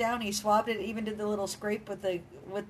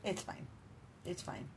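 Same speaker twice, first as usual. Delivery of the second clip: soft, self-consoling "It's fine"; trying to convince herself it's fine, quietly; resigned mutter; 6 semitones lower, 9 dB quieter than usual. Real, not acted. Resigned about missed movie.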